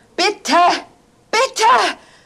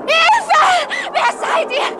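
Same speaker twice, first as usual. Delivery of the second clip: terrified screams; 7 semitones higher than usual. Acted. Woman confronting intruders in the dark, screaming in terror. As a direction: shriek at the strangers closing in.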